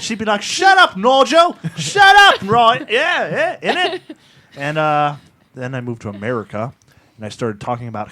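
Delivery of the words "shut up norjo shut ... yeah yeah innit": put-on high, loud shouting Yorkshire accent; shouted mock Yorkshire accent; mock Yorkshire mum. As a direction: bellow like a tipsy Yorkshire mother. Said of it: "she had a Yorkshire accent".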